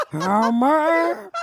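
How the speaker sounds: rising call of Homer, Marge-style; calling Homer with the upper lip thing. Retold or imitated. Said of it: "She d-, does the upper lip thing".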